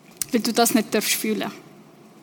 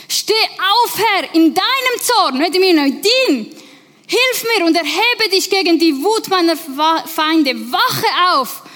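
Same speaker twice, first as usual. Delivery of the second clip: angry plea to God; 5 semitones higher than usual. Acted. David in the cave demanding God act, angry and higher. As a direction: cry out to God in frustration. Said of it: "er ist richtig hässig".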